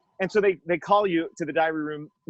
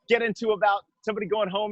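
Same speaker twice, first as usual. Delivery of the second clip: normal talking voice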